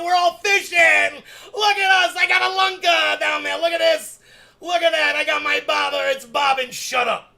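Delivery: playacting a fishing trip, hammed-up excitement; mock fishing excitement. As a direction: play an excited angler landing a big one.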